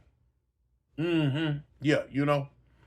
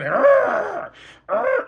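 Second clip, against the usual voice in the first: high, louder mock howls; imitating hollering; 12 semitones higher, 5 dB louder than usual. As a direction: howl like a man possessed. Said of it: "went to hollering".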